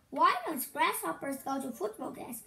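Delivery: ordinary delivery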